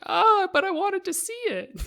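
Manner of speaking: high, whiny complaint; mock whining moviegoer. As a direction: whine like a spoiled viewer. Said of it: "And you're like".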